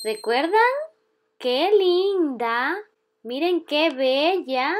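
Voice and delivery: ordinary talk, nothing marked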